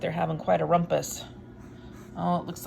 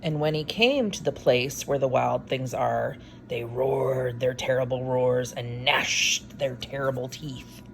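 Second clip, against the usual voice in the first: story narration; 3 semitones lower, slower than usual. A narrator's reading. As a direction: build the wild things up for the kids. Reads picture book aloud.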